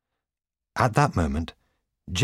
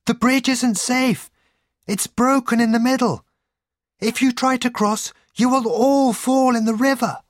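very high-pitched shouted warning; crying out; 5 dB louder than usual. A narrator's reading. Cottager warns travellers of the broken bridge, crying out. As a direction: shout it high and urgent across the distance. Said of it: "he cried".

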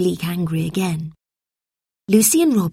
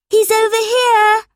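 put-on very high, loud girl's call; high-pitched excited shout; 6 dB louder than usual. A narrator's reading. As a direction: call your friends over from afar. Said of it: "she called".

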